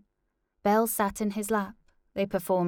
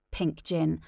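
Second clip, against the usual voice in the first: plain reading voice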